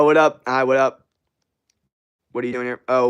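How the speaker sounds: usual speaking voice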